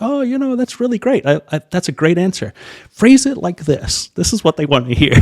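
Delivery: hammed-up enthusiastic mentor; mock gushing praise. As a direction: imitate the chief pilot raving over a good answer. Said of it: "He's like".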